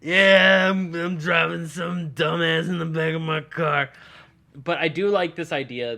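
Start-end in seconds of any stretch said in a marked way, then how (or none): 0.0-3.9 loud, high-pitched pretend road rage; hammed-up irate shouting; mock yelling. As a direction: yell it down an earpiece at a driver. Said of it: "just start yelling into it and being like".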